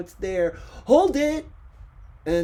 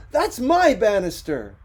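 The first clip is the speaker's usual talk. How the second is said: loud, higher, indignant; 9 semitones higher, 11 dB louder than usual. Acted. [name] confronting the model on his banister, indignant. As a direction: claim it hotly.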